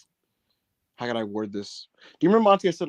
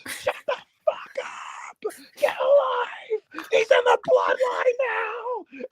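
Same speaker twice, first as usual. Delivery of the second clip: shrill-high hysterical scream, slow and stretched; mock the furious old lady screaming at the crowd; high drawn-out shrieking yell; screaming old woman; much slower than usual.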